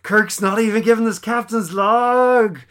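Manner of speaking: lightly hammed disbelief; mock exasperation. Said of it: "It's like".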